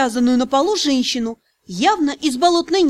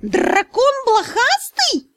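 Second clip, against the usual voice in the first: high, slow outraged spirit; furious; 9 semitones higher, much slower than usual. Acted. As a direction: shriek out the insult seething. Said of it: "разъярённо поинтересовался он".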